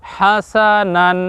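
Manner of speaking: হাসানান stretched long and even; very slow recitation. Shown as a demonstration. Teacher drills viewers on হাসানান very slowly. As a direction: hold each syllable of হাসানান. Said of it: "দুইটি শব্দ একবার বলুন".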